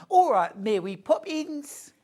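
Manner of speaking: over-the-top fake Cockney accent, high and loud. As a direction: ham up a terrible stage Cockney. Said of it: "the worst Cockney accent ever".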